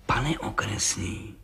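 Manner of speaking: slow, low, soft malice; vindictive. A narrator's reading. Policeman addresses the judge from the door, quietly vengeful. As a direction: say it quietly, out for revenge. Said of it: "řekl mstivě".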